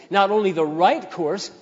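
ordinary talk

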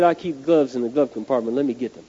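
lightly imitated Southern patrolman; low, casual hint of a Southern accent. Patrolman fetching gloves to help the driver, light Southern accent. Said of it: "in his southern accent".

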